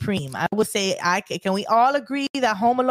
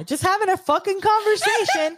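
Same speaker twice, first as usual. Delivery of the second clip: laughing; 11 semitones higher than usual. Real, not acted. Mocking the snowman movie plot.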